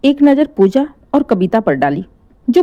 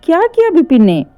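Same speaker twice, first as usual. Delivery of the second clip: shocked question; 7 semitones higher than usual. Acted. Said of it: "हैरानी से आंखें फाड़े बोली".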